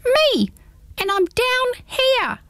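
very high, slow tiny creature voice; odd little voice. Acted. Hedgehog answers Pins from the ground, odd little high voice. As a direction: speak up from the grass. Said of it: "said the odd little voice".

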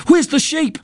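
high, urgent demand; child's urgent high-voiced shout, acted; shouted question. A narrator's reading. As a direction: burst out with it, pitched high. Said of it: "I shouted".

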